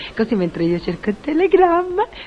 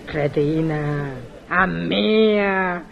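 outraged insult; 2 semitones lower, much slower than usual. Acted. Portiera insulted by phone caller, slow rising outrage. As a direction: stretch each word in mounting outrage.

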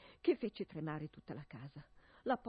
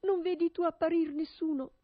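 high, loud cry to the tower; frightened wife calling loudly for help; desperate calling out; 9 semitones higher, 8 dB louder than usual. Acted. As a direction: call up to her sister in anguish. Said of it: "le gridava".

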